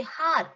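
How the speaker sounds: "har" imitating the pupils' hour; wrong hour, "har"; H sounded. Shown as a demonstration. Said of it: "Most of you all say har".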